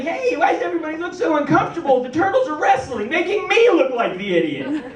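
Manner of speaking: put-on fast, high, eager kid chatter; mock hyper child; excited clueless kid. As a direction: rattle it off like an oblivious kid. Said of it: "running around like".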